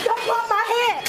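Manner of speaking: loud high pleading shout; panicked shout. Real, not acted. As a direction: scream it as they push you down. Being pushed into seat.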